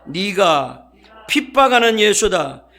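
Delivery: preacher shouts Jesus' words for the church to repeat; thundering proclamation. Acted. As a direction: boom each line like Jesus rebuking Saul. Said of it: "따라합니다".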